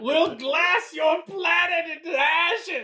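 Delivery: put-on high, slow New Zealand accent war cry; New Zealand accent screaming. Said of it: "wish I could do a New Zealand accent and just start screaming".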